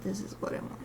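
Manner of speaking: whispered repeat to self; whispering. Real, not acted. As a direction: drop to a whisper.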